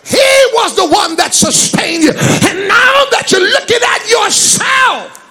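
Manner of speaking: loud, high, driving sermon shout; full-force preaching. Real, not acted. Preacher rebukes the congregation for looking at themselves, full-force preaching.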